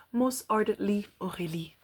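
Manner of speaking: ordinary talk, nothing marked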